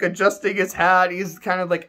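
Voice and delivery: normal talking voice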